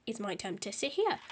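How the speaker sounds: ordinary delivery, nothing marked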